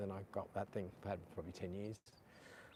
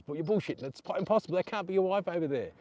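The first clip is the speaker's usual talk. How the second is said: mock disbelief; 8 semitones higher, 8 dB louder than usual. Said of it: "he thought I was".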